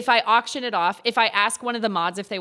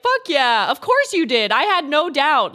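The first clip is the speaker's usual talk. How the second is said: louder, hyping her up; hyped friend; 4 semitones higher, 7 dB louder than usual. Retold or imitated. Dan hyping her up for fixing the Xbox, loud. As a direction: cheer like a proud buddy. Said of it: "he'll be like".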